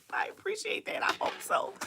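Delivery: whispered thanks. Real, not acted. Host whispering thanks to a kind viewer in the chat. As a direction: breathe the thanks without voice.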